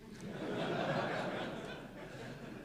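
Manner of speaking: low-key, breath-only laughter; soft laugh. Real, not acted. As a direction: laugh quietly without voice. Laughs after own joke.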